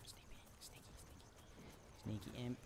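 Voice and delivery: whispered sneaky chant; playful hushed whisper. Pretend. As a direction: hiss it like a creeping thief. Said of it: "we gotta be sneaky imp".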